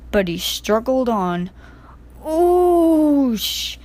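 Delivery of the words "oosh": long high hissing oosh. A narrator's reading. Percy's fire hit by water, long hiss. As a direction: hiss like steam on a doused fire. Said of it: "he hissed".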